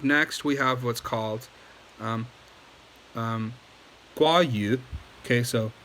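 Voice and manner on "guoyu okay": ordinary talk, nothing marked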